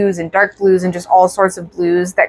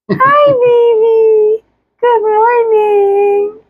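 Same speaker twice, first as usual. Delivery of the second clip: very high, slow cooing; 12 semitones higher, much slower than usual. Real, not acted. Greeting the baby.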